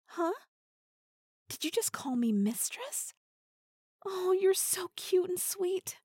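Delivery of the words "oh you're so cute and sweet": mock cooing. Teasing partner coos at him.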